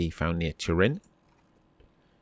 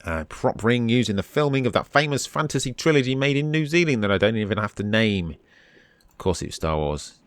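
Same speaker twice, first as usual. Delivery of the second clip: normal talking voice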